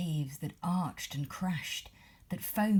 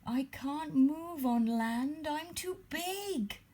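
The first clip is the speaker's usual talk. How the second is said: high, slow, hopeless moan; slow mournful whale lament; 12 semitones higher, slower than usual. A narrator's reading. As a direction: moan it out in despair. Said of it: "moaned the whale".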